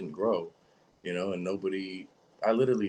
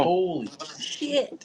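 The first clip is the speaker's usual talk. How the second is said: high, slow, stunned holy shit; shocked exclamation; 11 semitones higher, 3 dB louder, much slower than usual. Real, not acted. Friend hearing the joint price, stunned and drawn out. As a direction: let the price knock the wind out of you. Reacting to forty-five dollar joint.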